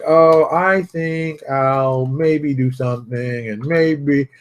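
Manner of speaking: slow, drawn-out wishy-washy voice; imitating an indecisive waffler; mock dithering. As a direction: drag each word out, hemming and hawing. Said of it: "waste time going like".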